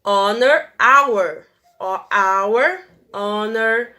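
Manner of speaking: "Honor" and "our" said with no h, starting straight on the vowel; teacher showing the silent H; silent h demo. Shown as a demonstration. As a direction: drop the h in "Honor". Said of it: "não consegue ouvir o H".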